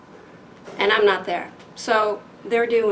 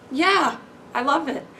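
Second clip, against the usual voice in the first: higher-pitched, delighted quote; enthusiastic reply; 5 semitones higher than usual. Retold or imitated. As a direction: voice a fan's eager yes, pitched up. Retelling enthusiastic emails.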